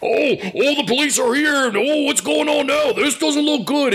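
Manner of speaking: high, excited mock commentary; mock announcer alarm in a high voice; imitated ringside announcers. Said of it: "the announcers are going".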